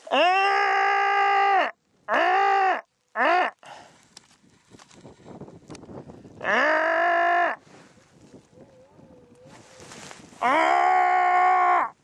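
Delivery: long loud calling ah; moose call. Real, not acted. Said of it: "Don't talk good, moose".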